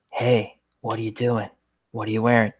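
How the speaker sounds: a hint of a soft, seductive recorded voice; sultry phone line. Dialing the warranty number reaches a sex line, soft come-on. Boss dials, same line.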